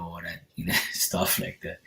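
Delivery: real emotion, laughing it off under the breath; a soft, breathy laugh while holding back tears; emotional laughing whisper. Real, not acted. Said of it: "I'm getting all emotional now".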